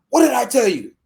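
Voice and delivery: loud high threatening question; angry demand. Retold or imitated. Landowner advancing on the girl, loud and angry. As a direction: bear down on her.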